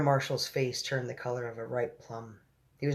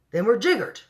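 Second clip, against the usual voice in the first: loud, higher exclamation; cried out; 9 semitones higher, 8 dB louder than usual. A narrator's reading. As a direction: cry it out as the army general. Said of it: "cried the ang".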